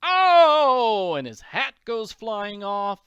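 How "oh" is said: long, high, very loud cry; pained scream. A narrator's reading. The Count bitten on the leg by Sandy, screaming. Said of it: "The Count screams".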